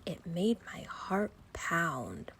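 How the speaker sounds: slow hushed whisper of fear; scared whisper. A narrator's reading. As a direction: breathe it out as if afraid to be heard. Scary moment under the bed.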